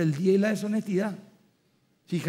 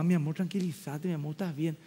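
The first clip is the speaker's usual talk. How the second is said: soothing mother; 3 semitones lower, 6 dB quieter than usual. Retold or imitated. Mother soothing crying daughter.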